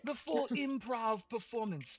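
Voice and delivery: usual speaking voice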